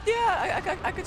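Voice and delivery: high loud startled yeah; surprised squeak. Real, not acted. Startled reaction.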